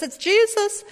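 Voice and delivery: high-pitched cry of recognition; excited exclamation. Acted. Disciple John recognizes Jesus from the boat, excited. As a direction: exclaim it with a sudden high lift.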